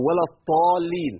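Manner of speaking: الطالين with ط in place of ض, a deliberate mistake; mock reciting error, ط for ض; wrong letter demo. Shown as a demonstration. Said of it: "أبدل الضاد بطاء".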